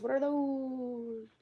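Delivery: soft, high, stretched vowel; drawn-out exclamation. Pretend. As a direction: let the last word hang, long and high. Drawn-out exclamation while scrolling photos.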